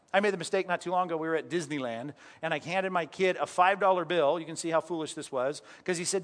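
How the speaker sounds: ordinary talk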